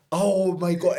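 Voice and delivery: normal talking voice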